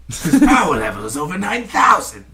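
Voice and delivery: lightly voiced Dragon Ball narrator; anime impression. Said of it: "how good that impression was".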